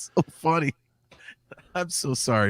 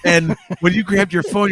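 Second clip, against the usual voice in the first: normal talking voice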